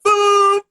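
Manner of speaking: loud, high imitated car horn; car horn honk. Driver honking to scare the teacher, loud mock horn.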